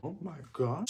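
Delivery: awed hush. Real, not acted. Tasting the bacon cookies.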